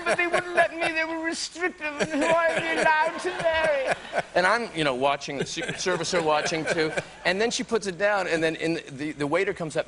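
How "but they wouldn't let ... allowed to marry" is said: pitched way up, mock-royal lament; high posh princess imitation. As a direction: play the tearful princess, high and fluttery. Princess Margaret quoted, high voice.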